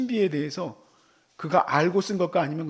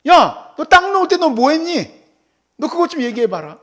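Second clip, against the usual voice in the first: higher, louder challenging voice of God; 8 semitones higher, 8 dB louder than usual. Retold or imitated. God questioning Job, higher and louder challenge. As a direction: demand an answer the way God asks Job. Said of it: "하나님이 이걸 왜 묻습니까?".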